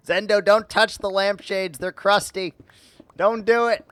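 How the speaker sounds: loud, high scolding call. Real, not acted. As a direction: shoo the pet off the lampshades. Scolding a pet loudly.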